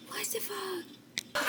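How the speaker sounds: slow, high, breathless whispered curse; stunned whisper. Real, not acted. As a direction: breathe it out in disbelief. After spilling her coffee.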